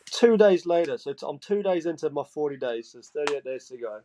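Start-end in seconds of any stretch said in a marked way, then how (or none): none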